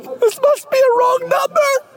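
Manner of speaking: high, stunned disbelief. Acted. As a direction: fan getting the unexpected text. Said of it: "And you're like".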